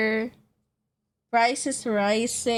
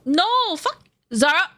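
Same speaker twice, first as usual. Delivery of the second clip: yelling; 8 semitones higher, 4 dB louder than usual. Real, not acted. Streamer yelling at friend Zara.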